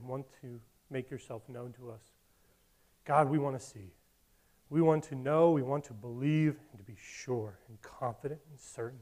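soft, low prayer voice; hushed sincere prayer; closing prayer. Real, not acted. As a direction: pray quietly for the church. Closes in prayer.

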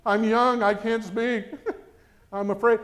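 half-laughing Moses excuse; Moses-like protest. Retold or imitated. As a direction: plead like a reluctant prophet. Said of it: "sound like Moses".

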